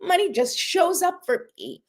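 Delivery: high, thrilled squeal over a nickel; mock delight. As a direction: gush like finding treasure. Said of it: "you go".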